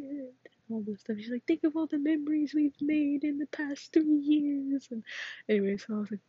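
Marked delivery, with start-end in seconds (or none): 1.5-4.9 slow, high, louder coaxing voice; pleading friend. Retold or imitated. Friend begging her to stay on the team, slow and coaxing. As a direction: draw it out to guilt her into staying.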